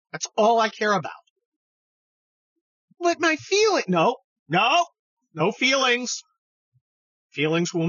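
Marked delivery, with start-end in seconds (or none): 3.0-3.8 much higher, pleading protest; high mock whine cut off midway; mock whiny objector. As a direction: parody a fan about to plead feelings. Imagined critic starts pleading.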